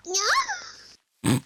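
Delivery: put-on very high, sweet anime girl reply; high cute anime girl. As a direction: answer like a cute anime heroine. Anime girl voice after remark.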